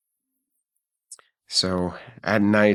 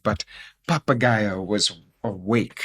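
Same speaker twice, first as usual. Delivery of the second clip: plain reading voice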